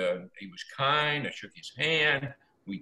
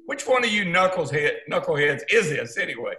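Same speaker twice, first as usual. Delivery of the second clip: teasing, higher voice, louder; mock accusing; 6 semitones higher, 4 dB louder than usual. Retelling prank call, teasing the friends he suspects. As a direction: call out the pranksters, half-joking.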